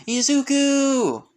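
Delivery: high, loud, drawn-out call of a name; excited cry. Acted. Karin leaps at Izuku, excited cry. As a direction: call out with delight, stretched long. Calling out joyfully in story.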